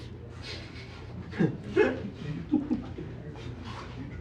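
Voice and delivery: soft unvoiced chuckle; breathy laugh. Real, not acted. Moderator laughs at slip.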